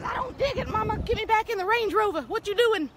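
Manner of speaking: high, petulant cat voice; voicing the cat. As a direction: speak for the cat, high and pouty. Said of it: "she says".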